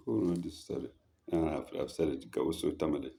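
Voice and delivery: usual speaking voice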